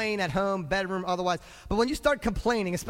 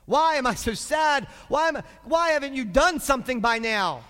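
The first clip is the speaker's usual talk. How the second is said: loud, high, indignant whine to God; raised-voice acted protest; pouting complaint; 6 semitones higher, 6 dB louder than usual. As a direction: complain loudly, demanding an answer. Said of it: "you begin to pout".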